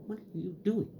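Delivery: lightly voiced puzzled staff; quiet mock question. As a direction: imitate a baffled clerk calling. Librarians calling after him.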